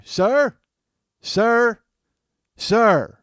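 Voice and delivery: loud, high hail to a stranger; calling out. Retold or imitated. Scalper hailing a man who won't turn around, calling out. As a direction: holler to get someone's attention.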